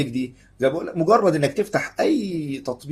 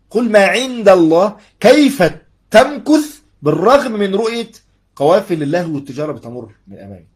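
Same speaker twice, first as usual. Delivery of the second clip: impassioned preaching; 4 semitones higher, 9 dB louder than usual. Real, not acted. Preacher challenges listener to endure trials, loud impassioned appeal. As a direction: press each phrase out loud and high. Preacher applies the verse, voice raised.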